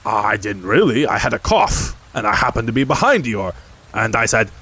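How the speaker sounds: a boisterous tiger making excuses; Tigger. A narrator's reading.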